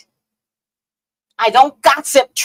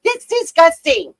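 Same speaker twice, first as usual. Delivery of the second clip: high, louder, slowed-down scolding; disgusted scolding; 11 semitones higher, 3 dB louder, slower than usual. Retold or imitated. Scolding her sister over the shared toothbrush, high and appalled. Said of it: "I said".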